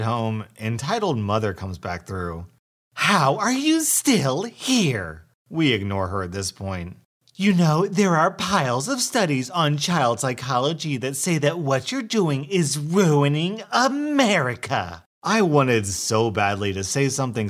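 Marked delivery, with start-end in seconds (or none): 2.9-5.2 high, slow and incredulous; exasperated entitled mother. Acted. Entitled mother returns, outraged. 7.3-15.0 preachy entitled mother. Acted. Entitled mother lectures again.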